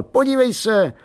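put-on high, urgent boyish call; excited calling out. A narrator's reading. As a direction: call to mum for attention.

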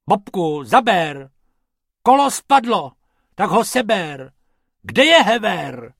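loud, high squabbling orders; bickering shout. Acted. Two rabbits squabbling over the fallen wheel, loud shouting. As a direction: bark at your partner while working. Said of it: "trochu se hádali".

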